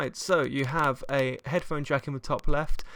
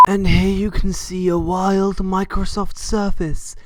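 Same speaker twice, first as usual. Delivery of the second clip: slow, louder wildlife narrator; nature documentary; 4 semitones higher, 7 dB louder than usual. Pretend. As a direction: narrate it like a wildlife film, slowly. Wild gadget in natural habitat.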